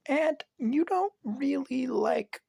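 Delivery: put-on very high, slow little girl; child voice. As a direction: drag it out like a puzzled kid. Child voice questioning Vlad.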